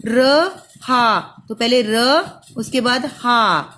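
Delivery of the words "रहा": drawn-out sounding. Shown as a demonstration. Teacher sounding out रहा slowly for pupils. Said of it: "अब रहा देखिए बेटा कैसे लिखा जाएगा".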